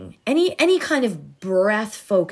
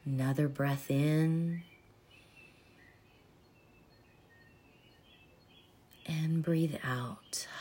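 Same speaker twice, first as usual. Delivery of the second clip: soft spa whisper; 2 semitones lower, 8 dB quieter than usual. Acted. Leads a body breathing meditation.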